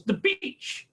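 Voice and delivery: high-pitched, drawn-out punchline; gleeful reveal. Retold or imitated. Caller revealing where she grieves, gleeful. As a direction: imitate her delighted reveal, voice lifted high.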